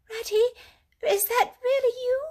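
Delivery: small, high, hopeful mole voice; feeble voice. A narrator's reading. Lost Mole calls from the tree hollow, high and feeble. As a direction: peep out of a hole, barely daring to hope. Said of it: "came a feeble voice saying".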